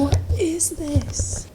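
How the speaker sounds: soft slow whisper. Real, not acted. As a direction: breathe it out slowly. Whispering in quiet building.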